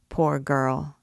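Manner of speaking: ordinary delivery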